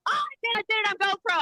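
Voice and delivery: high, rushed triumphant report; excited friend impression. Mimicking her recruit reporting success, high excited rush. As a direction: gush it quickly in a put-on high voice.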